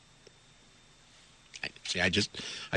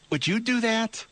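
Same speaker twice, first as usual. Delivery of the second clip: incredulous; 12 semitones higher, slower than usual. Real, not acted. Host reacts to guest's actions.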